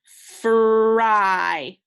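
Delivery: teacher models Fry, held loud; drawn-out read. Shown as a demonstration. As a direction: stretch out Fry. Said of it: "let's read this word together".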